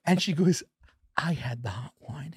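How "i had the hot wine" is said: soft unvoiced whisper of a confession. Girlfriend whispering back during the concert, hushed whisper. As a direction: whisper it close to his ear. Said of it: "we're whispering this whole thing".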